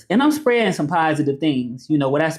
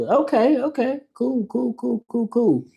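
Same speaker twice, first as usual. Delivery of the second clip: much higher chirpy okay and cool; 9 semitones higher, slower than usual. Real, not acted. Reading a viewer's message, answering in a high voice. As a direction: bounce up high on each cool.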